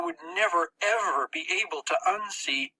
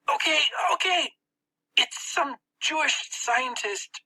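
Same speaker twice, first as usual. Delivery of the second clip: higher, slow spat confession; 11 semitones higher than usual. Acted. Max spitting out the target under pain. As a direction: give it up grudgingly in a boy's voice. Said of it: "Max spat out".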